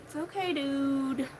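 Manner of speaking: reassuring. Retold or imitated. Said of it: "I'm like".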